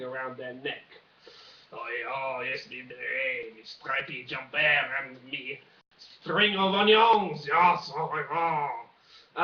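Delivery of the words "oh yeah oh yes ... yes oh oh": low, slow lightly put-on French accent; a drawn-out French "oh yes" of the beret and onions; mock French accent. Listing French clichés, slow mock French accent. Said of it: "my French accent probably needs a lot of work".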